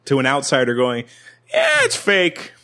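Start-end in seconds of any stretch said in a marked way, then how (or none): 1.5-2.5 mock brush-off squeaked very high; a silly falsetto shrug of an answer; mock dismissive. Committed magician brushing off an outsider, mock falsetto.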